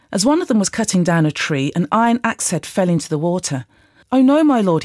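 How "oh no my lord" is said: higher-pitched, mildly alarmed outcry; narrated cry of distress; dismayed cry. A narrator's reading. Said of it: "he cried out".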